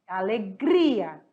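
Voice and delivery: Alegria slowly drawn out, the gri syllable held; stress on GRI. Shown as a demonstration. Teacher slowly sounding out Alegria for the class, leaning on gri. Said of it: "ela é uma liana".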